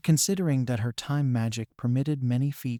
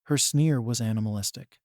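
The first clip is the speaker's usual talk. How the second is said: usual speaking voice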